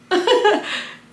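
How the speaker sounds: high unvoiced laugh; airy giggle; breathy laugh. Real, not acted. Laughing at the question.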